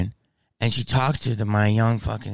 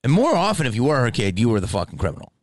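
ordinary delivery

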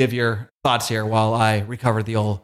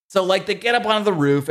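usual speaking voice

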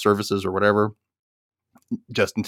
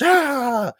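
higher mock yell of frustration; mock frustrated yell. [name] wailing on the door, mock yell. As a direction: yelp like someone fighting a stuck door.

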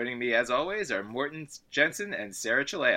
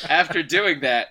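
words pushed out louder through a laugh; 6 semitones higher, 10 dB louder than usual. Real, not acted. Host laughing about the blown lead, talking through laughter. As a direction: still laughing as you go on.

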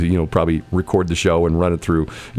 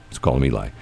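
ordinary talk, nothing marked

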